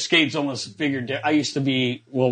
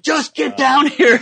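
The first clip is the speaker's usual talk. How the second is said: much higher, louder, slow laughing yell; laughing shout; 12 semitones higher, 9 dB louder, slower than usual. Retold or imitated. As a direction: yell it down the hall, cracking up. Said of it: "guys would be like".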